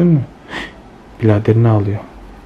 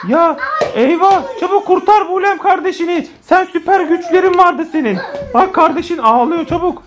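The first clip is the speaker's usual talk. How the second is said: high, theatrical cry for help; high-pitched pretend distress; mock urgent plea; 7 semitones higher than usual. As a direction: plead playfully for a rescue. Begs superhero sister to save brother.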